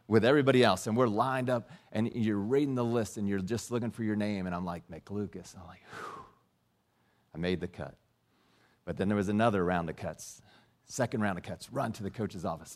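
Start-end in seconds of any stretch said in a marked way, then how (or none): 4.9-7.9 relieved under his breath. Retold or imitated. Reading cut list, relieved.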